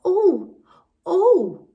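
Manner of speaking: high, loud shocked gasps; children crying out in shock at the fallen tree. Retold or imitated. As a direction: play the shocked children seeing the goat. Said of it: "they're going".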